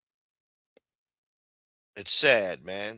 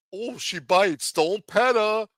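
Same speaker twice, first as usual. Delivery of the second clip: mock gator keeper; 9 semitones higher, slower than usual. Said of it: "He could go swimming with the gators".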